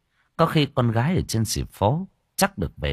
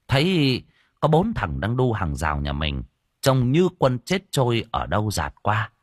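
ordinary delivery, nothing marked

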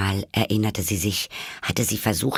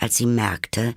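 ordinary delivery, nothing marked

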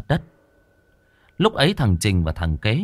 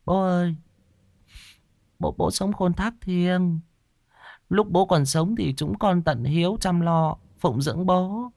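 woman's murmured prayer; 9 semitones higher than usual. A narrator's reading. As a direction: pray gently to the dead father. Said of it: "Kim rì rầm khấn".

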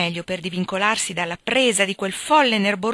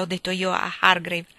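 ordinary delivery

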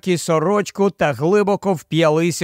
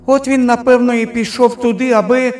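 put-on high, loud child complaint; high-pitched kid explaining loudly; little boy's voice; 6 semitones higher, 4 dB louder than usual. A narrator's reading. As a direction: insist like a small boy. Boy insists he wanted a drink.

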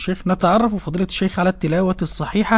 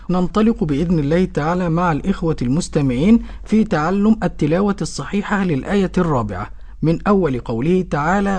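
ordinary talk

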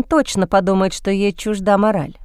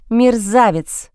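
slow, hissed through clenched teeth; through gritted teeth; much slower than usual. A narrator's reading. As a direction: spit it out slowly through the teeth. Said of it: "сквозь зубы процедила Джейн".

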